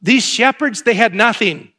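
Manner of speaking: loud, high preaching build. Acted. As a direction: preach it to the back row. Preaching at full force.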